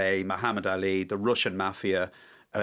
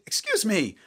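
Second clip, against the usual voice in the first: higher mock call of excuse me; retold shout; 9 semitones higher than usual. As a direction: lightly imitate her calling out. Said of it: "So she shouted".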